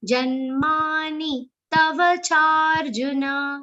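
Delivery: "charjuna" ending with a complete "na"; full final na. Shown as a demonstration. Teacher correcting a clipped ending in recitation. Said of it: "complete the sound of na".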